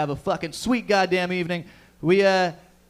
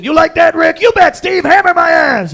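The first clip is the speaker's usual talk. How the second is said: yelled much higher and louder; hammed-up macho shouting; 10 semitones higher, 13 dB louder than usual. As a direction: bellow it like two meatheads. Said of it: "no emotion".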